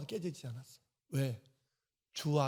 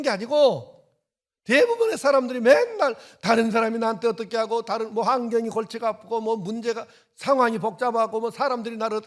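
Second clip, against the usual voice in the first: loud, raised preaching voice, pushing hard; preaching at full force; 8 semitones higher, 13 dB louder than usual. Acted. Pastor scolding the congregation's excuses, loud preaching. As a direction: thunder it at the congregation.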